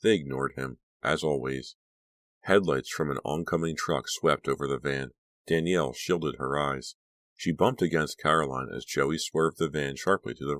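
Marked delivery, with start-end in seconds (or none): none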